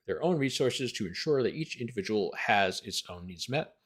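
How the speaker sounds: ordinary delivery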